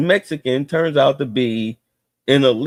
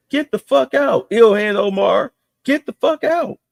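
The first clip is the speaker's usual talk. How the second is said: even higher, yelling get-out; angry outburst; 8 semitones higher than usual. Real, not acted. Telling Ilhan Omar to leave, angry high yell. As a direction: escalate the order to leave.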